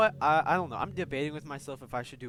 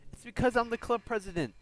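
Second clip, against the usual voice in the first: raised, high, insistent voice; agitated confession; 7 semitones higher, 5 dB louder than usual. Acted. Monika explaining herself to the club, agitated high voice. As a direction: pour it out with mounting desperation.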